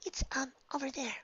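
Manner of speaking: soft breathy whisper; shy hushed voice; timid whisper. Acted.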